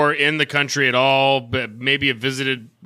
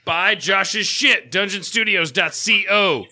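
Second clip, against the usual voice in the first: hyped plug; 5 semitones higher, slower than usual. Pretend. Host plugging the boss's shop, hyped. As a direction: sell it big and slow.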